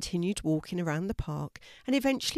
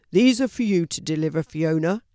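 brisk, louder postman handing over parcels; postman voice, louder and quick; 7 dB louder than usual. A narrator's reading. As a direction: postman points Fiona to her parcels, brisk and loud.

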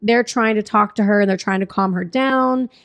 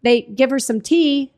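ordinary delivery

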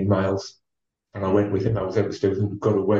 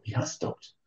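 whispered thought; 10 dB quieter than usual. Retold or imitated. Inner thought while racing.

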